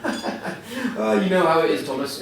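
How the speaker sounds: British understatement. Retold or imitated. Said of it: "in that very British way".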